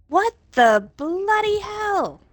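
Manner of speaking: slow, high mock British exclamation; what the bloody hell, drawn out in a mock British accent. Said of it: "That's very British".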